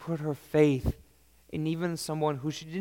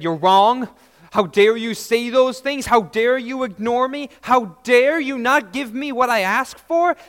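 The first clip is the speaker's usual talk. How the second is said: louder, higher outraged comeback; indignant retort; 7 semitones higher, 9 dB louder than usual. Pretend. Woman imagined scolding Jesus, outraged and loud. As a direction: hurl it back in offended anger. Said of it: "backhanded Jesus and said".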